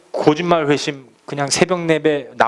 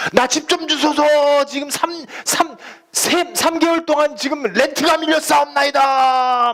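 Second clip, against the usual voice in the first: mock showy prayer; 11 semitones higher than usual. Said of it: "내 기도 사방 사람들 다 알려".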